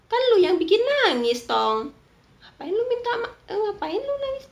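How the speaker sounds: high, loud indignant scolding; shrill-free loud outburst at the cheating husband; exasperated high shout. Real, not acted. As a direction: yell at him for making her cry. Host scolds husband in story.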